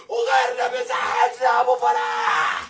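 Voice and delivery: ordinary talk, nothing marked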